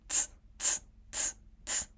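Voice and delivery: "ts" as a bare consonant with no vowel; soft, voiceless "ts" bursts; unvoiced ts. Shown as a demonstration. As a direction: blow air through "ts", no voice at all. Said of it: "this tsu is unvoiced".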